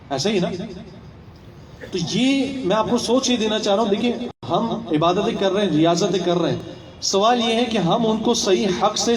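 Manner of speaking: usual speaking voice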